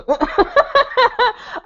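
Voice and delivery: high, rapid cackle; real laugh. Real, not acted. Vlogger realising she was talking to herself, real high laughter. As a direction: burst out laughing at yourself.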